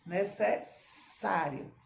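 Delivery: "Necessário" very slow, the ss a long snake hiss; slow "necessário" with a drawn sss. Shown as a demonstration. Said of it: "ó, o sonzinho da cobra".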